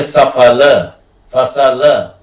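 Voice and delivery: tongue at the teeth on the स; teacher demonstrates the dental स in example words. Shown as a demonstration. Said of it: "वो दंती सा निकलता है".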